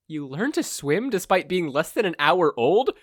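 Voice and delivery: incredulous captive. Acted. Captive mother questions the villain, incredulous. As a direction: poke holes politely.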